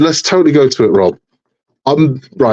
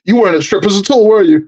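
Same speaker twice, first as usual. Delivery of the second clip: higher-pitched mock interrogation; accusing wife; 9 semitones higher than usual. Wife catching husband in a stripper alibi, mock accusing. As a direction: imitate a suspicious wife catching him out.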